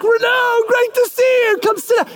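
author welcoming the fan; welcoming celebrity. Acted. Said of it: "and they're like".

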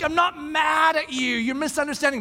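defensive. Retold or imitated. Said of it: "And I'm like".

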